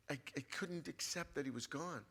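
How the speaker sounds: usual speaking voice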